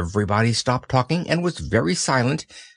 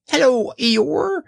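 very high squeak; excited baby squeak; squeaky Roo; slower than usual. Acted. Roo speaking.

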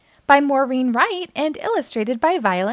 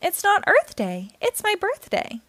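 higher, proud, singsong claim; a silly monkey proudly insisting; 8 semitones higher than usual. A narrator's reading. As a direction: insist on your birthday with silly pride. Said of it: "proudly said".

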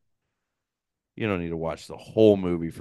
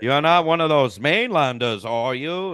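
loud, higher-pitched put-on Maine accent; 6 semitones higher, 5 dB louder than usual. Mimicking the Stephen King series accent, thick mock Maine accent. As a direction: play a suspicious Maine islander with the accent. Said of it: "like, the main accent".

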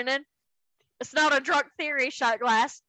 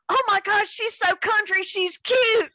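high, cooing mock gush; 6 semitones higher, slower than usual. Soldier marvelling at her country accent, imitated gushing. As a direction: gush as if over something adorable. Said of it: "somebody said".

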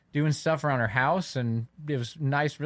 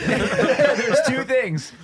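high, loud, slow words through laughter; real laughter; laughing; 11 semitones higher, 7 dB louder, much slower than usual. Real, not acted. Laughing restart.

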